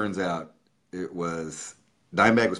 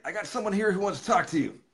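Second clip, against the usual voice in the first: high, quick, excited party voice; 12 semitones higher, faster than usual. Retold or imitated. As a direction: rattle it out high, imitating a partying buddy. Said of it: "He's like".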